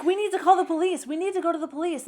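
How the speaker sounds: high, loud, fast insistence; retold urgent plea. Boy insisting they call the police, mock urgent. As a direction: imitate a frantic kid demanding help.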